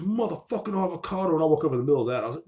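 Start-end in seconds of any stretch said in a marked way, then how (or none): 0.0-1.3 higher-pitched retold outburst; mock outraged scream. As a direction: imitate waking up outraged, pitched up. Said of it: "I woke up that night screaming".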